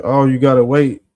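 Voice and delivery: usual speaking voice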